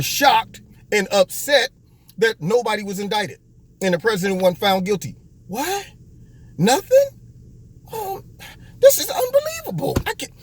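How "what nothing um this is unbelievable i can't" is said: mock whiny shock. Mocking reporters shocked at outcome.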